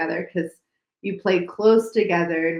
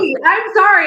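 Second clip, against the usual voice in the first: high, louder, tearful sorry; tearful apology; 12 semitones higher, 9 dB louder than usual. Real, not acted. After being told not to cry, tearful sorry. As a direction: apologize through welling tears. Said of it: "don't cry now".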